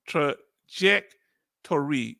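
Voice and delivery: trajectory drawn out in separate syllables; deliberate, halting trajectory with a clear J; careful pronunciation. Shown as a demonstration. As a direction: slowly sound out "truh ... JEK ... tor-ee" for trajectory. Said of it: "let me say trajectory".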